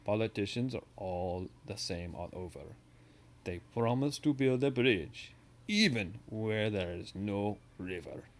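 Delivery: hammed-up Russian accent telling a joke; teacher's mock Russian joke voice. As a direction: put on a Russian accent for the punchline. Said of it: "let's do a Russian for you here".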